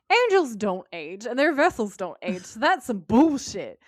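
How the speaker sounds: loud exasperated scoff at the show; indignant inner voice. Retold or imitated. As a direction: call out the nonsense, mock outraged. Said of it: "I was thinking to myself".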